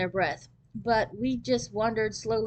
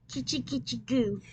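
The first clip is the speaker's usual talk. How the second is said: put-on baby talk; 3 semitones higher, 3 dB quieter than usual. A narrator's reading. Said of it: "baby talk voice some grown-ups use".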